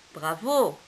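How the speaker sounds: usual speaking voice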